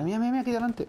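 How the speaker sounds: sudden high excited alarm; panicking gamer. Real, not acted. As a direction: call it out, pitch shooting up. Alerta al ver al enemigo. (jugador en pánico)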